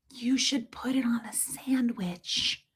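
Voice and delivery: slow, breathy secret whisper; mom's whisper. Retold or imitated. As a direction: whisper the tip slowly, like a secret. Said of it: "she does whisper sometimes".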